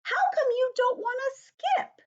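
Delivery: put-on very high, loud child voice; high-pitched, insistent kid; little-girl friend Grace. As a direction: squeal it as a puzzled schoolgirl. Voicing Grace in the story.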